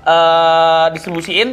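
ad read. Acted. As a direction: read it like a sponsor spot. Promo read continues.

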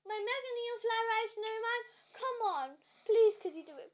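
urgent, hopeful appeal; excited pleading. Real, not acted. Pleading for trade to happen.

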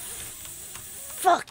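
slow, breathless whisper under the breath; whispered curse. Real, not acted. Gamer fed up with the game, slow whisper. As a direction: hiss it out slowly to yourself.

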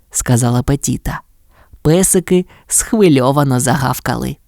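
usual speaking voice

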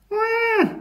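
very high, drawn-out wail; squeaky animal wah; baby seal cry. A narrator's reading. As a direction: bleat like a lost baby seal. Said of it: "went the baby seal".

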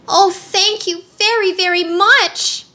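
high, drawn-out thanks; gushing girlish gratitude; grateful cry. A narrator's reading. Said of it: "cried Dorothy".

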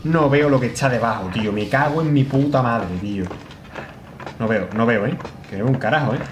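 ordinary delivery

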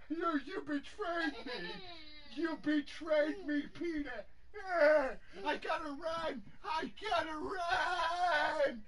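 high, hammed-up wail; play-acted tearful cry of betrayal; mock betrayal. Mock betrayal during play with nephew.

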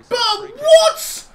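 high, loud laugh of disbelief; laugh-shout in total disbelief; disbelieving laugh. Real, not acted. Gamer stunned by the call, laughing shout.

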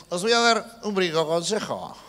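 slow imitation of a DIY host's accent; drawn-out parody of the handyman's accent; mock TV presenter's accent. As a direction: put on the presenter's accent, slowly. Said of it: "Pone el acento suyo y se dice".

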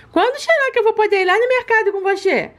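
high, sweet little pet voice begging for treats; baby-voiced pet. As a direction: talk like a greedy cute puppy asking mommy. Pet asks to go along.

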